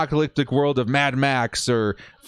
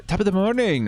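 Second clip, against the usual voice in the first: put-on a half-hearted, failed stab at an Irish accent, pitched higher; attempted Irish accent; 8 semitones higher than usual. Greeting an Irish fan in a bad Irish accent. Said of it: "I can't do an Irish accent".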